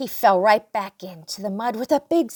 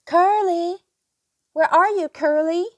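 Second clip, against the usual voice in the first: calling out; 7 semitones higher, 7 dB louder than usual. Acted. Calling in the garden.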